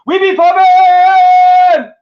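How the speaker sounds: drawn-out high hype yell. Real, not acted. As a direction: stretch it out like a hype man. Hyped sign-off shout.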